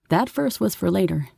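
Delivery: usual speaking voice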